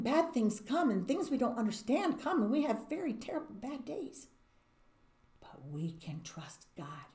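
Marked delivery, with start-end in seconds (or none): 5.4-7.1 slow, whispered comfort; soft reassurance. Real, not acted. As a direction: whisper it like a promise. Gentle closing reassurance.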